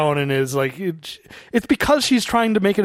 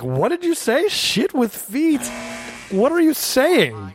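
higher, slow, drawn-out disbelief; a higher, slowed-down, half-joking what-did-you-say; mock bafflement; 8 semitones higher, slower than usual. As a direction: imitate a baffled actress, slowly. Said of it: "the actress was going like".

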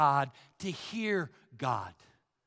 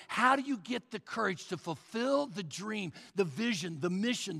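ordinary talk, nothing marked

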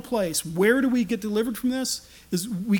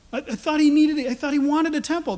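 high, louder puzzled objection; baffled protest; 7 semitones higher, 4 dB louder than usual. Retold or imitated. A reader finds no temple in the city, baffled. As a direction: protest in acted bewilderment, pitched up.

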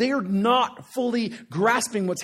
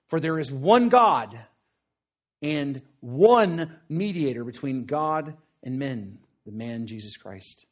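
slow, weighty reading of the verse; scripture reading; 5 semitones lower, slower than usual. Acted. Preacher reads Paul's words to his congregation, slow and solemn. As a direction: read the verse slowly and with gravity.

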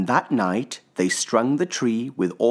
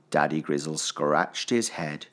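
usual speaking voice

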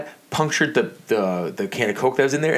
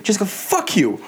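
exasperated snap; 10 semitones higher than usual. Retold or imitated. Snapping at sister on phone.

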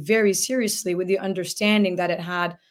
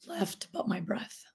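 breathy hushed whisper; 13 dB quieter than usual. Retold or imitated. Quoting the doctor's testimony.

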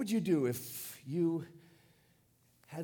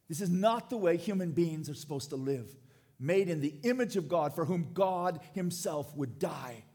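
louder, forceful preacher's protest; impassioned lament; 2 semitones higher, 5 dB louder than usual. Acted. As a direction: drive the lament home with force. Same lament repeated at full force.

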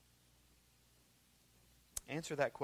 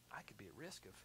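softer, unvoiced inner thought; whispered thought; 4 semitones lower, 14 dB quieter than usual. Preacher voices a sobering private thought, whispered. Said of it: "a sobering moment to think".

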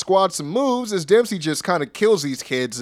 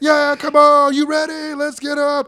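high, loud mock rallying cry; hammed-up hype; 12 semitones higher, 5 dB louder, slower than usual. Mimicking Apollo's old persona, hammed-up hype. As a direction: yell it way up high to pump up a crowd.

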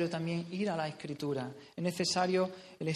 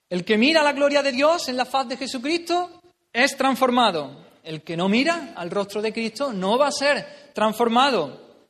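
loud, higher, urgent preaching; preaching at full force; 6 semitones higher, 8 dB louder than usual. Real, not acted. Preacher urging the congregation, loud fervent preaching. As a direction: drive the point home to the church.

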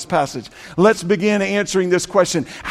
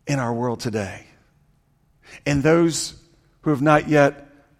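intimate hushed preaching; 7 semitones lower, 7 dB quieter than usual. Acted. Long hushed sermon stretch.

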